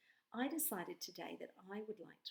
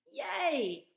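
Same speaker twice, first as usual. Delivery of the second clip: high loud cheer; squealed yay; excited yay; 10 semitones higher, 10 dB louder than usual. Acted. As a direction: cheer it out like a delighted child. Teacher reading cheerful poem line.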